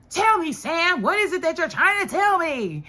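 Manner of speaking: high, loud mock pleading; demanding Eli impression. Recapper acting out Eli pressing Sam, high insistent demand. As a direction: press for an answer in a hammed-up high voice.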